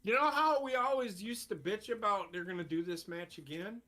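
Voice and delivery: ordinary talk, nothing marked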